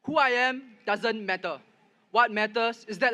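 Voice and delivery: ordinary talk, nothing marked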